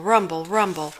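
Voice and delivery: usual speaking voice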